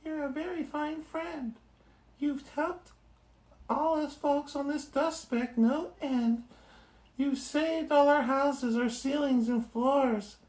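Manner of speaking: a tiny voice from a dust speck; squeaky little mayor; tiny Who voice. Acted.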